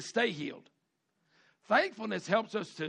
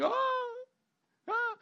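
mock moaning. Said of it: "they're moaning, they're weeping".

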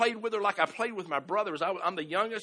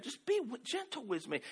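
gentle plea; 5 semitones higher, 6 dB quieter than usual. Retold or imitated. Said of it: "in kind of a high pitch, almost kind, a nice way".

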